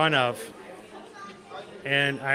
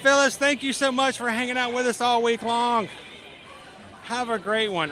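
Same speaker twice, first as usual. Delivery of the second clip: cheery, raised sing-out farewell; high-pitched thanks; 8 semitones higher than usual. Real, not acted. Host thanking Phyllis for the week, high cheery shout-out. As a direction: send thanks out high and bright.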